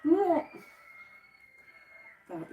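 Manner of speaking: ordinary talk, nothing marked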